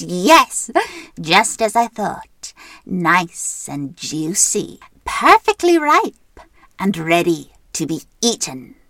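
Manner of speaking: slow, loud greedy witch; gloating witch. Acted. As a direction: savour each word like a witch. Witch gloats over juicy child.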